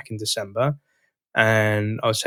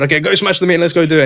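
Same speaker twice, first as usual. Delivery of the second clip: louder, higher pep talk; hype-up; 6 semitones higher, 6 dB louder, faster than usual. Retold or imitated. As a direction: pump her up before the call. Coach hyping rep before meeting.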